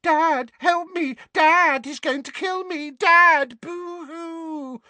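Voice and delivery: very high, loud whiny wail; mock crying for help. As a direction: wail like a crybaby. Dudley mimicking Harry's cries.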